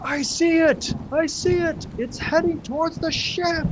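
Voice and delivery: very high alarmed lookout cry; shout. Acted. Minzu the navigator warning the ship, high alarmed shout. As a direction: call out the danger in a high, strained voice.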